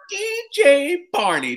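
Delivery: very high, loud mocking old man voice; Cosby impression. Comic mimicking Cosby greeting him on set, high and mocking. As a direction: squeal it out like a teasing old star.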